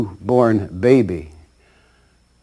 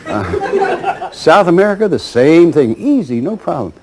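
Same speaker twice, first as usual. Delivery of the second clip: laughing salesman; 6 semitones higher, 4 dB louder than usual. Retold or imitated. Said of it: "he says".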